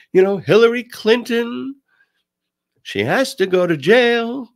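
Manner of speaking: a light Trump imitation, tune lifting at the end; Trump impression. Mocking Trump's speaking style with a sing-song rise. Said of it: "sing-songing upward inflection".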